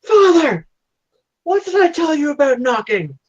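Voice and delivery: high-pitched mock yell at father; indignant daughter yelling. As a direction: play a scandalized daughter crying out. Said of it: "she yells out".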